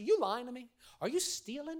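high-pitched mock accusation; accusing mother. Mother suspecting her child of stealing, mock accusing. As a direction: act out a suspicious mom's grilling.

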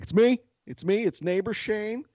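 high, urgent calling voice. Retold or imitated. Neighbor sticks his hand out to wife, calling out. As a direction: imitate announcing yourself round a corner. Retold calling out to armed homeowner.

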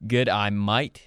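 put-on Australian with rising MATE; Australian accent. Shown as a demonstration. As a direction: echo an Australian greeting, lifting "mate". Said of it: "an inflection that goes up".

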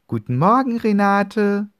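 calling out high and loud from the window. Acted. Girl calls good morning down to the neighbour, loud. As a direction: shout the greeting down to the street. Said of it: "rufe ich laut hinunter".

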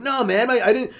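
high whiny denial; guy lying about his wet shoes, high whine. Acted. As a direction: deny it in a high, defensive voice. Denying wet shoes, voiced guy.

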